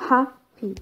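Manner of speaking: "Happy" with HAP stressed; stress on first syllable. Shown as a demonstration. Teacher showing adjective stress to learners, first-syllable stress on "Happy". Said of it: "adjective first syllable".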